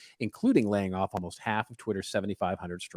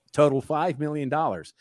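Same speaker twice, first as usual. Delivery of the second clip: usual speaking voice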